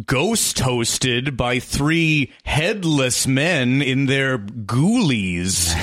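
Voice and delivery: slow, drawn-out Haunted Mansion host; mock-spooky ghost host welcome, slowed right down; ghost host parody.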